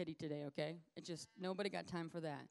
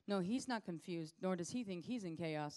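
ordinary talk

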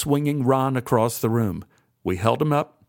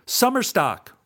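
beaming; 9 semitones higher than usual. A narrator's reading. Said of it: "Ron beamed".

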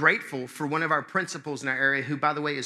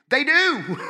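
high, loud mock outburst; exasperated retort; 11 semitones higher, 8 dB louder than usual. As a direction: fire back loudly, pitch shooting up. Exasperated retort to mimicked complaint.

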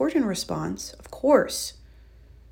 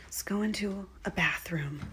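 hushed whisper as she walks in; 7 dB quieter than usual. Real, not acted. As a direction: whisper it, sneaking in. Whispering while entering bathroom.

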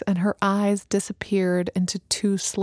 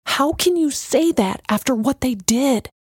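it bursts out before she knows it; yelling; 5 semitones higher than usual. A narrator's reading. Alana yelling at Messenger over the destruction, outraged. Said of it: "surprised to hear herself yelling".

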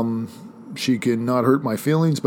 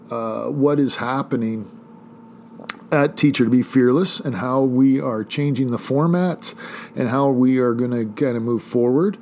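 usual speaking voice

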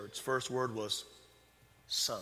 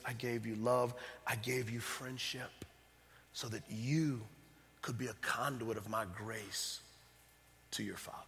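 God's tender voice; 5 semitones lower, 6 dB quieter than usual. Retold or imitated. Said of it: "he was saying".

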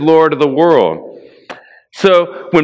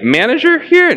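incredulous; 11 semitones higher than usual. Retold or imitated. Incredulous coworker asks about Jeff.

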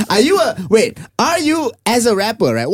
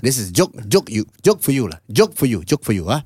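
lower-pitched Eurasian uncle with halting phrases; Eurasian uncle accent; 5 semitones lower than usual. Retold or imitated. Uncle offers a joke to a comedian, Eurasian uncle accent. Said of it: "Eurasian man tell you?".

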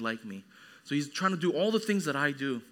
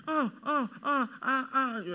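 put-on high, short wordless toddler pleas; imitated toddler begging noises; 8 semitones higher, slower than usual. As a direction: mimic a toddler nagging for food. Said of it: "making these noises as if he".

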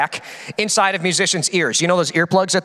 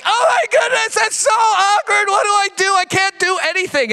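mock panic; 13 semitones higher than usual. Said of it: "I was like".

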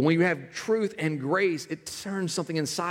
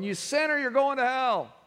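mock street preacher; 9 semitones higher than usual. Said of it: "standing on street corners going".

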